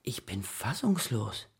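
breathless, slow stunned whisper; barely able to get the words out. Acted. Father stunned that daughter cancelled his date, whispered.

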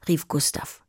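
ordinary delivery

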